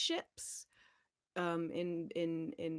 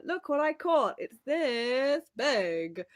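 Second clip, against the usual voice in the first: mock fisherman boast; 6 semitones higher, 6 dB louder, slower than usual. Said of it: "being like".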